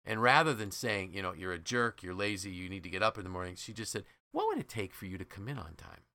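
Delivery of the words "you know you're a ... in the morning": normal talking voice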